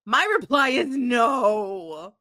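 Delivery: slow, loud proclamation; shouted verdict. Acted. Announcing the Magic Eight Ball's verdict, a slow shout.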